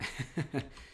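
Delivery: short airy giggle; amused breathy laugh; soft giggle. Real, not acted. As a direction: chuckle at the idea. Laughs at bread as rice.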